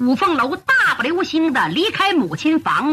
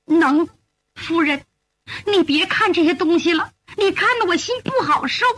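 higher-pitched maid, gently pleading; upset young maid; 4 semitones higher than usual. Acted. Maid begging her mistress to stop looking, upset.

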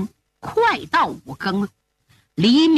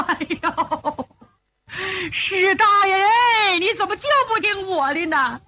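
wailing cry; 8 semitones higher than usual. Acted. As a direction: break down crying over the lost elder. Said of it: "李明放声大哭".

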